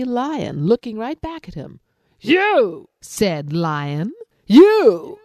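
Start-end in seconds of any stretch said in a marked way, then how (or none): 2.2-2.9 loud, much higher lion yell; lion yelling at his reflection; angry shout. Acted. 4.5-5.2 loud, much higher lion yell; lion yelling at his reflection; angry shout. Acted. Echo lion.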